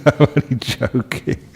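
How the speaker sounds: chuckling through the words; laughing. Real, not acted. Speaker takes back a joke to the monks, laughing.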